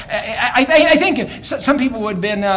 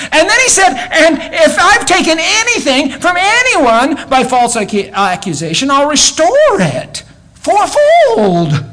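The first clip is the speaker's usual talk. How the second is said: full-force preaching; 11 semitones higher, 6 dB louder than usual. Acted. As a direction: preach it out loud and high, ringing to the back pews. Preaching at full force, Zacchaeus' vow.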